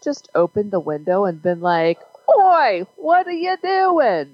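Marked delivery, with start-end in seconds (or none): 2.3-4.3 loud, high mock British "Oi, what are you doing?"; British accent. S0 yells at the backyard intruder in a mock British accent. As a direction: ham up a British neighbour yelling from the window. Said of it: "That was my British accent".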